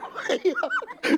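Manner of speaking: delighted high laugh; high squealing laugh. Real, not acted. Laughs at own joke.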